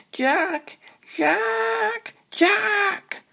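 high, rising calls for her brother; calling out. Acted. Little sister calling her brother from the treetop, high shout. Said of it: "call at the top of her lungs".

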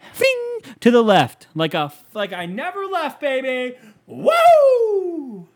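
high, loud hollering that climbs; loud outburst. Real, not acted. Telling of his golf swing gone left, loud outburst. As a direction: build to a whooping, drawn-out peak. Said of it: "Didn't mean to get so loud".

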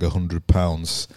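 normal talking voice

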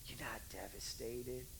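slow, soft whispered correction. Retold or imitated. Parent hushes the devastated teen, whispering. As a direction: whisper it gently and slowly.

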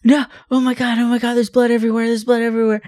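frantic, very high falsetto; mock panic. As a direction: play hysterical in a thin high voice. Prank feigning a bloody accident.

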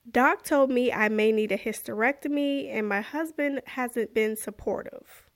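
normal talking voice

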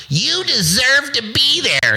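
high, slow mock-sweet voice; mocking flatterer. Mimicking fans telling him he deserves it, mock-sweet. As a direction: parrot the flatterers in a silly high voice.

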